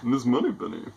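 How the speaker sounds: jokey, badly faked film-spy impression; Roger Moore. Homebrewer has another go at an actor, hammy impression. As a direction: put on a suave actor's voice, badly. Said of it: "really terrible Roger Moore impression".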